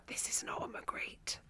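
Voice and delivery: hushed, unvoiced whisper in someone's ear. Shown as a demonstration. Comedian leaning over a gallery-goer's shoulder, whispering. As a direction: lean in and whisper it confidentially. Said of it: "lean over someone's shoulder and whisper".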